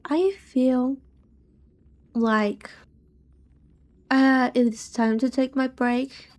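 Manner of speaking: normal talking voice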